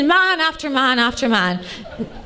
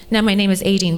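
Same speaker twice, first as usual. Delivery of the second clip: usual speaking voice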